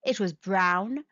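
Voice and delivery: usual speaking voice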